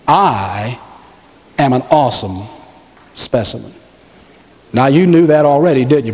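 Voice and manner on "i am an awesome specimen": low, halting, phrase by phrase; measured prompting. Shown as a demonstration. Preacher leads congregation through "I am an awesome specimen", measured prompting.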